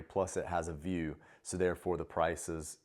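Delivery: ordinary talk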